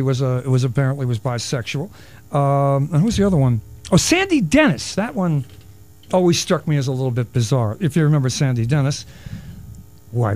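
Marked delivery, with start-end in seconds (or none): none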